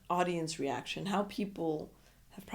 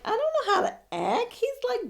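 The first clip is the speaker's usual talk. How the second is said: put-on much higher, starstruck girly voice; shy crush. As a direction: gush like a flustered crush. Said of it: "She's all shy".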